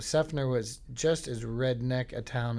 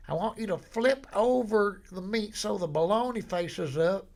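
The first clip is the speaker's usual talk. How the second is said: high, slow, thick Deep South drawl; 10 semitones higher, 5 dB louder, slower than usual. Retold or imitated. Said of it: "barely intelligible ... from the deep, deep, deep South".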